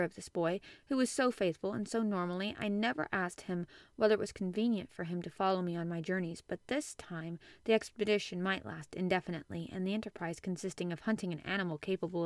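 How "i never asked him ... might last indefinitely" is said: ordinary delivery, nothing marked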